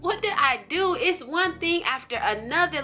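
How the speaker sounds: high exasperated plea to Lord. Retold or imitated. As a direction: throw it up high in despair. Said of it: "But I'm like".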